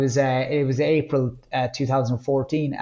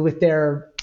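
normal talking voice

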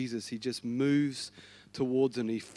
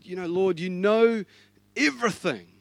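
exasperated; 4 semitones higher, 6 dB louder than usual. Acted. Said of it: "Peter gets totally exasperated".

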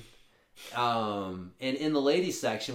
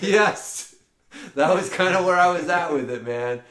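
emphatic agreement; 6 semitones higher, 10 dB louder than usual. Real, not acted. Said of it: "laughing agreement".

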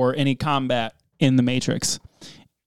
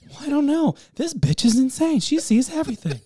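raised voice, mock bewildered; pitched-up incredulous aside; higher acted inner voice; 8 semitones higher than usual. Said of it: "I was like".

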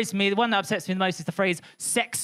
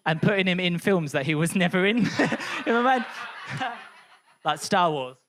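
ordinary delivery

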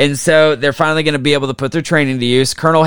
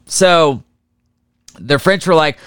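normal talking voice

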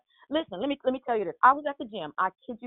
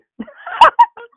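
loud, high burst of laughter; 15 dB louder than usual. Real, not acted. Laughing at own remark on counting days, loud laugh.

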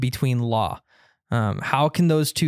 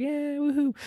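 mock woohoo; 12 semitones higher, 4 dB quieter than usual. Mocking Christians' cheerful reaction.

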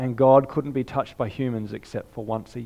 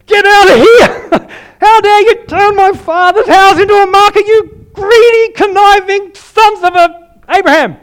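furious, roaring high and loud with a laugh breaking through; violent furious shout; 15 dB louder than usual. Acted. Preacher voicing Jesus at the money changers, furious roaring shout. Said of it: "But probably more violently".

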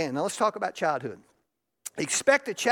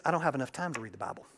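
excuse-making churchgoer; 7 semitones lower, 7 dB quieter, much faster than usual. Retold or imitated. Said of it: "So it sounds like this".

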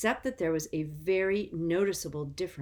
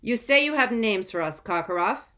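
stern official; 6 semitones higher, 8 dB louder than usual. A narrator's reading. Crouch interrogates the prisoner.

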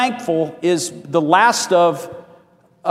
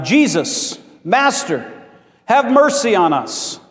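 higher, drawn-out plea for mercy; crying out; 5 semitones higher, slower than usual. Acted. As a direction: call out pleadingly from a distance. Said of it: "stood at a distance crying out".

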